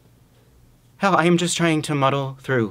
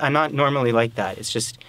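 normal talking voice

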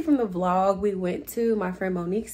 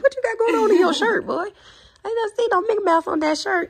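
put-on high, fast sassy baby voice, pitched up; voicing the baby; 11 semitones higher, 4 dB louder, faster than usual. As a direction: speak for the baby, teasing the boy about his shirt.

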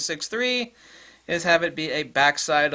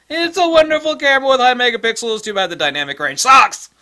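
loud, high-pitched mock customer complaint; mocking shout; 9 semitones higher, 10 dB louder than usual. Reviewer trashing a high-megapixel camera, mocking shout. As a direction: shout it like a scornful camera verdict. Voices a dissatisfied camera buyer, sarcastically.

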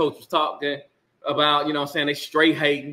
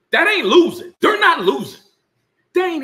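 emphatic shout; 11 semitones higher, 4 dB louder than usual. Real, not acted. Insisting Colorado is winning.

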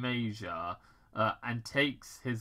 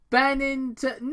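much higher, louder, slow answer; Major; 9 dB louder than usual. Acted. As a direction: say it proudly. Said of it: "your name".

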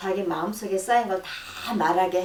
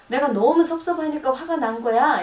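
louder, higher upset complaint; hurt girlfriend; 6 semitones higher, 5 dB louder than usual. Retold or imitated. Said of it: "이렇게 말을 하면은".

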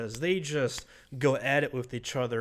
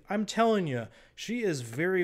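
usual speaking voice

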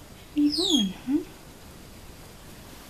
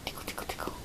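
whispering; 13 dB quieter, much faster than usual. Real, not acted. Tickling the pet.

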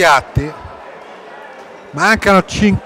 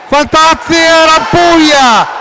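rising, louder play-by-play shout; excited commentary; 6 semitones higher, 5 dB louder than usual. Real, not acted. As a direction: call the chance with mounting excitement. Live play-by-play near goal.

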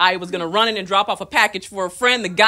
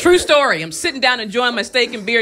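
ordinary talk, nothing marked

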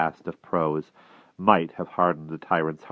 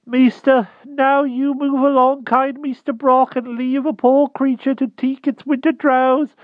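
humble hedgehog voice; 8 dB louder than usual. Acted. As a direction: squeak it out humbly like a shy hedgehog. Hedgehog keeps speaking.

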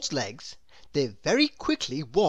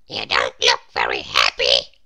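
loud, tiny character voice; Mister Small; 9 dB louder than usual. A narrator's reading. Mister Small questions gloomy Mister Tall, tiny voice. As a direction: pipe it out as a very little fellow. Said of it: "said Mister Small".

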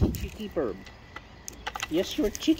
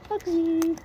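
calling the bird's name much higher; high pet call; 10 semitones higher than usual. Acted. Calling the bird.

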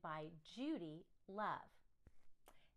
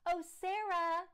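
loud, much higher gushing greeting; 9 semitones higher, 8 dB louder than usual. Acted. Principal greeting Sarah at the car, gushing. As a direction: gush over her sweetly. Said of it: "Missus Burton gushed".

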